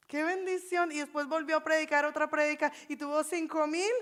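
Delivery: normal talking voice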